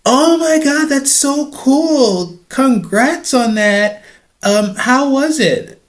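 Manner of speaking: put-on high, slow gushing girl voice; starstruck girl. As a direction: stretch every word like a dazzled fan.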